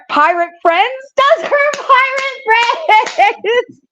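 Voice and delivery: very high, drawn-out mocking squeal; incredulous mockery. Reader mocking the pirate friends line, incredulous high squeal. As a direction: stretch it out high, cracking up.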